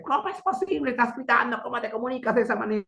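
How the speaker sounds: high-pitched imitation of an indignant colleague; mock scolding. Colleague rebukes him for all-caps messages, mock scolding.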